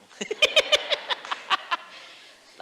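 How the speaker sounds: loud high burst of laughter; preacher laughing at the story. Real, not acted.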